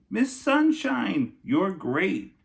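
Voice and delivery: put-on high, slow little-kid voice; child's sweet voice. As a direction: read it like a small child's proud note. Child's card voiced in story.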